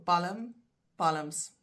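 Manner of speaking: palm, palms in two syllables with a sounded l; Afrikaans pronunciation. Shown as a demonstration. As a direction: say palm as "PAL-uhm", palms as "PAL-uhms". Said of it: "in Afrikaans".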